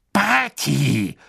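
ordinary delivery

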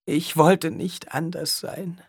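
high, slow lament; despairing. A narrator's reading. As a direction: drag it out in grief. Alia in despair.

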